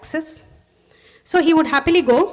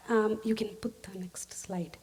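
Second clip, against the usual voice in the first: quick hushed aside to the helper; whispered request for the next slide; 2 semitones lower, 11 dB quieter, slower than usual. Real, not acted. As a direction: whisper to the tech, off mic.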